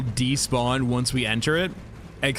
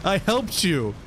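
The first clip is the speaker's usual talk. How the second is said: higher, pleading for peace; being chased; 6 semitones higher than usual. Real, not acted. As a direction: beg it not to fight. Pleading with hostile mech.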